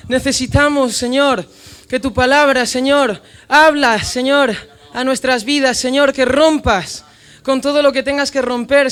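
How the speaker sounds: loud, impassioned preaching prayer; fervent loud prayer. Acted. Pastor praying over his church aloud, fervent loud prayer. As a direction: cry out to God with full force. Prayer rising to full force.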